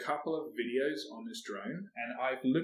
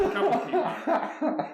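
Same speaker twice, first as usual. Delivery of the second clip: squeaky joke voice; 6 dB louder than usual. Pretend. Joking about Doctor Spacelove.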